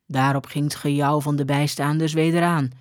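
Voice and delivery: usual speaking voice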